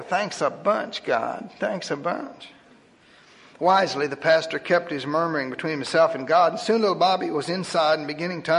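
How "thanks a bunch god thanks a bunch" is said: sarcastic thanks. Acted. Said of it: "He was thinking".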